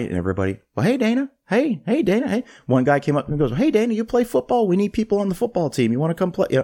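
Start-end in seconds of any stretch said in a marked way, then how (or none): 0.8-2.4 high, imitated hellos from passing classmates; friendly hallway greetings. Classmates greeting the new celebrity in the halls, high-pitched hellos. 3.6-6.5 high-pitched imitation of an inviting schoolmate; eager recruiter. Schoolmate recruiting Dana for football, high and eager.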